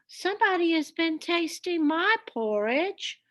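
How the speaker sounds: a lightly voiced mama bear complaining; middle-sized bear voice. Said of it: "in her middle-sized voice".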